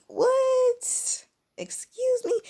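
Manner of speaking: very high, incredulous squeal; high-pitched, retold shock at an outrageous offer; shocked disbelief. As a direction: squeak it out, appalled. Retold shocked reaction to his offer.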